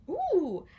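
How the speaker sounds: high gleeful ooh; delighted ooh. Acted. Sheriff dreaming of parties, high gleeful ooh. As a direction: squeal of villainous delight.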